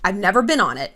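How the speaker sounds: ordinary talk, nothing marked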